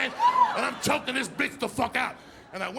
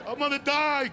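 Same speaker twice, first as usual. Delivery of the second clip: melodramatic mom; 6 semitones higher, 4 dB louder than usual. Retold or imitated. Acts out scolding Cuban mother.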